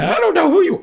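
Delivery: choked-up falsetto of someone overwhelmed; reliving crying. Retold or imitated. Retelling meeting Jesus to the host, crying high-pitched. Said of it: "And I'm crying".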